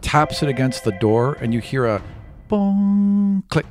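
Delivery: ordinary delivery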